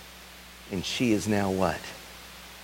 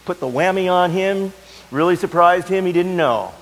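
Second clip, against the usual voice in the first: high and loud, driving; impassioned preaching; 9 semitones higher, 6 dB louder than usual. Acted. Preacher imagining the woman's life, high and driving. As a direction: hammer each point home.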